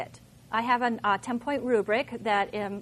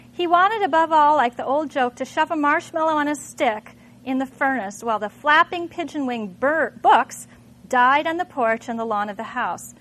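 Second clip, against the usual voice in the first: dramatic reading; 2 semitones higher, 8 dB louder than usual. Acted. Teacher reads Fahrenheit passage to class, dramatic read. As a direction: read it like the flames are roaring.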